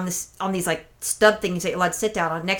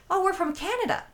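high, soft voice for a tourist; imitated tourist; 11 semitones higher, 5 dB quieter than usual. Canadian tourist answering where they are from, imitated high and soft. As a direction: lift it high and light, imitating her.

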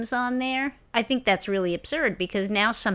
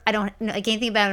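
normal talking voice

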